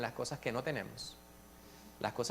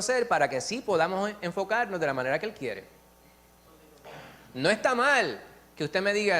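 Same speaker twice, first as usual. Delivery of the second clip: raised, loud pulpit voice; fervent preaching; 5 semitones higher, 5 dB louder than usual. Acted. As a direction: drive the point home to the congregation. Preaches at full force.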